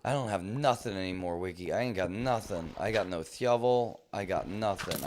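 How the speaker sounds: ordinary talk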